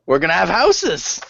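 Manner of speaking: high-pitched, gleeful exclamation; excited announcement. Acted. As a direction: burst out with the happy news in character. Character repeats the happy news.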